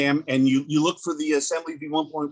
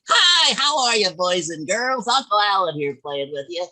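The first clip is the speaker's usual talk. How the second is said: big high-pitched show-host greeting; children's entertainer; 6 semitones higher, 4 dB louder than usual. Acted. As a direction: open the show loud and high, hammed-up for the kiddies. Mock kids' entertainer on-air opening.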